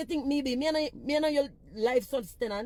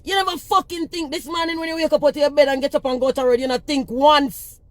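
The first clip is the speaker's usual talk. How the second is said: angry scolding; 4 semitones higher, 12 dB louder than usual. Real, not acted. Scolding a commenter on live.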